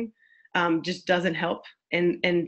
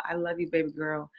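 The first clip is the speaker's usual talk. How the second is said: softer, hushed comfort; tender reassurance; 4 semitones lower, 8 dB quieter than usual. Shown as a demonstration. Parent softly comforting a lashing-out daughter. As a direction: soothe an angry child gently. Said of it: "you tell your child".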